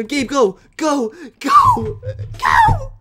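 screamed, very high and loud go; real shrieking cheer for Gabe; frantic cheering. Real, not acted. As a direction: scream him on like his life depends on it. Cheering teammate in final fight.

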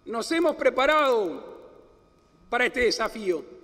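high, ringing oratory building up; booming balcony speech. Acted. Climax of inaugural address.

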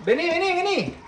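high, repeated pet call; coaxing call. Real, not acted. Owner calling the parrot to come, high coaxing. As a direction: coax a bird over in a high voice.

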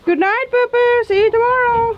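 high, sing-out goodnight call. Real, not acted. Vlogger bidding the animals good night, calling out high.

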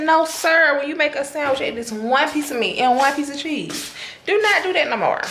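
put-on high, firm scolding of a child. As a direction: lay down the rule to her son. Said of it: "I said".

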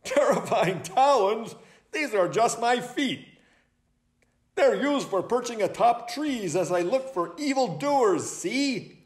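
high, laughing owl voice; high-pitched, tickled self-defence; amused owl. Acted. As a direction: laugh off the charge. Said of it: "Hubert laughed".

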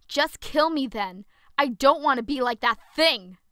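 loud, fast despairing yell. Acted. Zoe begging Penelope to kill her, loud anguished yell. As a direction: hurl it out in despair. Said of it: "I yelled".

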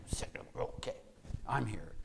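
whispered, grudging; breathy resentful whisper; sulky murmur. Retold or imitated. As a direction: mutter it like a sulking kid. Sulking son sits down.

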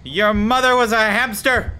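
mock-heroic jeer; taunt. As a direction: hurl a silly insult across the distance. Shouts taunt at creature.

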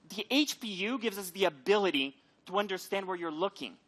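usual speaking voice

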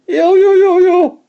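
very high, loud yo yo cries; men cheering in the rain; joyful whoops. A narrator's reading. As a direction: whoop with wild delight. Men dance crazily in the rain.

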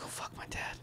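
acted shocked whisper to oneself; stunned whisper. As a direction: breathe it out in dread. Acted shock at news of death.

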